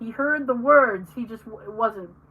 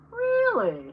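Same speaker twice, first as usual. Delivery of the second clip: mock Jay's really; 10 semitones higher than usual. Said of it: "he'll go".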